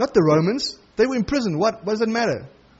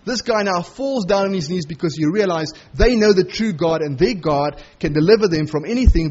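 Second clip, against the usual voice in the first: ordinary talk, nothing marked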